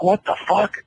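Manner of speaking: sudden high alarmed outburst; startled. Retold or imitated. Hearing his mother scream at night, startled high. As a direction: jump up in pitch as if shocked.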